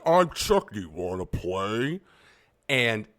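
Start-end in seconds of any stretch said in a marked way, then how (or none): 0.0-2.0 mock creepy Chucky voice, dropping low and slow at the end; lightly spooky doll voice; Chucky sample. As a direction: imitate the rave sample of a killer doll. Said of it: "rave song that sampled Child's Play".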